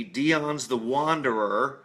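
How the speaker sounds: ordinary talk, nothing marked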